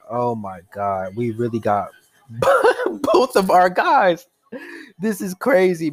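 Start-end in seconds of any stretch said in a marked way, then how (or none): 2.3-3.1 giddy laugh. Real, not acted. Overjoyed at getting both players. 3.1-5.9 thrilled fan can't believe it; overjoyed. Real, not acted. Overjoyed at getting both players.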